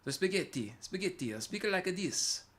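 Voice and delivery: shaky attempt at an Italian accent. Shown as a demonstration. Streamer testing whether he can do it, tentative Italian accent. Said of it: "How do you do Italian accents?".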